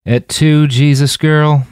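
high, loud, drawn-out disbelief; loud incredulous cry; exasperated outburst. A narrator's reading. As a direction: throw up your hands in disbelief. Roland's dramatic frustration.

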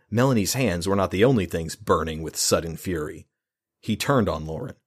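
ordinary delivery

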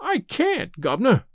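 high, whining plea of a hungry beggar; beggar's whine. A narrator's reading. Hannay begging the old gentleman, in a beggar's whine. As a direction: whine it out like a starving tramp. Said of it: "in a real beggar's whine".

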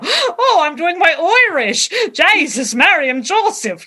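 a high, hammed-up teenage Irish accent; mock a high school kid faking Irish; bad Irish accent. Mocking teens in a school play faking Irish, hammed-up Irish accent. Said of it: "the Irish accent".

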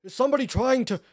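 high, loud cry through a door; trapped captive calling out urgently. Acted. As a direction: shout for whoever is outside.

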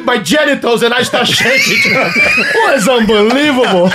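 laughing through the words; cracking up while telling it. Real, not acted. Laughing as he tells the guys his story.

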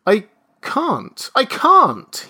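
high, anguished moan that builds. A narrator's reading. Father despairs at the thought, moaning. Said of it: "he moaned".

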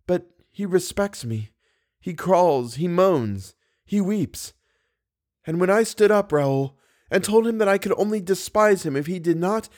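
high, anguished heroine; high-pitched, fervent young woman's voice; impassioned confession. A narrator's reading. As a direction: pour it out with horror and pity. Christine's impassioned account of Erik.

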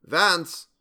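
high, loud shout of a name; loud call. A narrator's reading. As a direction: call out to someone down a dark passage. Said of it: "called out in a loud voice".

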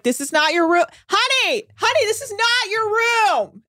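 high-pitched exasperated call; imitating someone calling out to a lost honey; mock scolding. Retold crew scolding drunk guest.

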